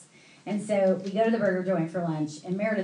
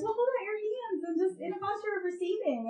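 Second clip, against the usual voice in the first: high, softer mimicked instruction; gentle instructing friend; 11 semitones higher, 5 dB quieter than usual. Friend readies man for prayer, high and soft. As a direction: coax in a sweet high voice.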